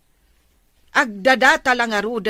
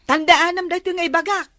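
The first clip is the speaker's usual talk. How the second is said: stern scolding; 5 semitones higher, 5 dB louder than usual. Acted. Mother scolds daughter.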